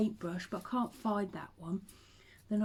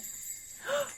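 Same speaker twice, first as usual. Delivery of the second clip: excited gasp; 4 dB quieter than usual. Retold or imitated. Children hearing Santa arrive.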